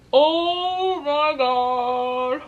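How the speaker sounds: loud, very drawn-out cry of awe; real overwhelmed exclaim; awed. Real, not acted. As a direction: stretch it out, overwhelmed. Overwhelmed by the gift.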